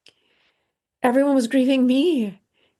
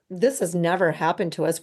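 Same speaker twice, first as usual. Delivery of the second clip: usual speaking voice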